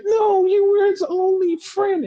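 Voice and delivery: high, crying mock wail; hammed-up tearful lament; mock heartbroken. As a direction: parody a sobbing fan. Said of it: "people were so, like, heartbroken".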